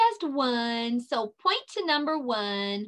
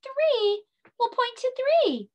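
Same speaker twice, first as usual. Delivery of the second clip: excited; 12 semitones higher than usual. Real, not acted. Cheering the child.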